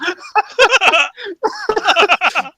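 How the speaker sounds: high-pitched laughing fit; burst of laughter. Real, not acted. Laughing at the assistant's mistake.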